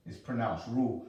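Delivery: Ru, clipped after the u; pronounced Ru. Shown as a demonstration. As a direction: say Ru short, with a plain u and no final ch.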